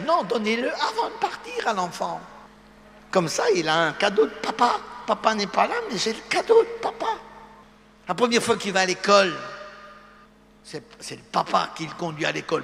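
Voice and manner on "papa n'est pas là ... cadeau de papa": put-on high little child's voice, proud. Child boasting about the gift from papa, high child voice. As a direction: play the small child holding papa's gift.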